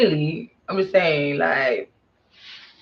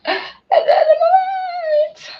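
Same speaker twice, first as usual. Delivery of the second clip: very high, loud, stretched-out squeal; high drawn-out wail; 7 dB louder, much slower than usual. Real, not acted. Admitting they didn't think about it, high loud wail. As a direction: wail it slowly at the top of your voice. Mock wail at own mistake.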